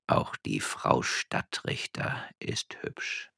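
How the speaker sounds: slow, whispered sly refrain; sinister slow whisper to himself; devilish muttering. A narrator's reading. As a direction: whisper it slowly, with devilish relish. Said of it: "den teuflischen Satz wiederholte".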